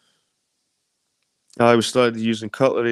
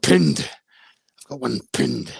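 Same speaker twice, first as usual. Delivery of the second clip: mock Scottish accent. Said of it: "my new friends were like".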